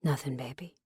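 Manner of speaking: low, soft growl of a man. A narrator's reading. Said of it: "he growled".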